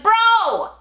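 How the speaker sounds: high, drawn-out mock complaint; exasperated protest. Driver to a pushy windshield washer, mock exasperated.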